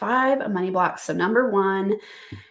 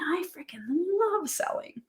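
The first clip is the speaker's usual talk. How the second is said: whispered delight; 10 semitones higher, 6 dB quieter than usual. Real, not acted. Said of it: "her own love of selling".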